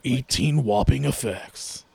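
low, slow, hammed-up announcer; mock announcer. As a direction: interrupt with a drawn-out grand ad voice.

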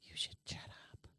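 hissed whisper, no voice at all; whisper yelling. Acted. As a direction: whisper it sharply to shut him up. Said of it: "Why are you whispering?".

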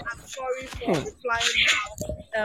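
slow, soft imitation of an apology; mockingly drawn-out, softly spoken sorry; mock apology. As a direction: drag out someone else's apology quietly. Retelling a late apology with scorn.

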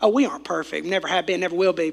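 ordinary delivery

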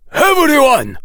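loud, high shouted order; commanding yell to the whole group; military command shout. A narrator's reading. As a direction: shout it like a drill officer. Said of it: "shouted Auror Komodo in a voice of military command".

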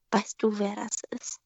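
slow, secretive whisper; a colleague whispering behind her back; whispered gossip. Retold or imitated. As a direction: lean in and whisper the secret slowly.

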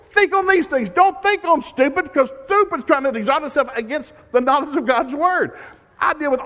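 loud, high, driving preacher's call; full-force preaching. Acted. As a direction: thunder it out to the congregation.

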